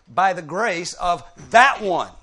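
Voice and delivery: slow, loud build to THAT; very emphatic. Shown as a demonstration. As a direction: proclaim it, THAT hit hardest. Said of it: "a very emphatic way to say it".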